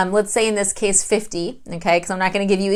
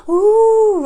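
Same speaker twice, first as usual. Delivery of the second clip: high, loud "Ooh" of joy; delighted ooh; 11 semitones higher, 5 dB louder than usual. Retold or imitated. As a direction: coo over the gift in delight. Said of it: "But I'd be like".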